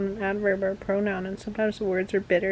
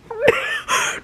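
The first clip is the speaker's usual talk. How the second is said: shrieking laugh; 5 dB louder than usual. Real, not acted. Laugh after quarrel.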